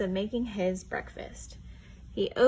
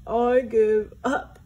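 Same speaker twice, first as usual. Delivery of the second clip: slow, loud blubbering give-up; mock-blubbering surrender; 8 dB louder, slower than usual. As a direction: wail it out like a defeated bear. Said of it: "he blubbered".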